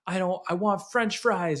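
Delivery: a parent's imitation of a fussy child; imitated demanding child. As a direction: lightly mimic a kid insisting on food. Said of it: "he just gaslights me all the time".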